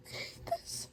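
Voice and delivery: soft, unvoiced-sounding tearful whisper; heartbroken whisper. Real, not acted. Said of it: "I'm heartbroken".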